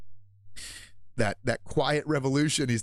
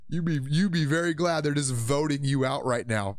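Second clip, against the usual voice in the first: ordinary delivery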